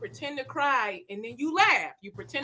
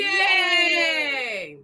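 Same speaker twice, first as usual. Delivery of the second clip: excited yay; 6 semitones higher than usual. Acted. Cheer ending the exercise.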